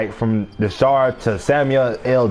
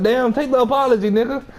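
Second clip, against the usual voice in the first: higher exasperated quote; 8 semitones higher than usual. Retold or imitated. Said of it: "I was like".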